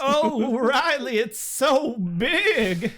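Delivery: high, drawn-out mock swooning; hammed-up dramatic reading. Mock Buffy marvelling to Riley, hammed-up dramatic reading. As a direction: gush it slowly with exaggerated innuendo. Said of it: "It is not dramatic reading worthy".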